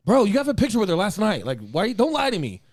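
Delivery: high, loud mock accusation; loud accusing. Man confronting friend over photo with Kate, high loud accusation.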